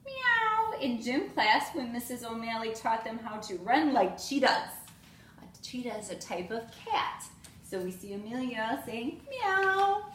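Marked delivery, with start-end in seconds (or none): 0.0-0.7 high, loud cat meow. Acted. Amelia meows like a cheetah. 9.3-10.1 high, loud cat meow. Acted. Amelia meows again.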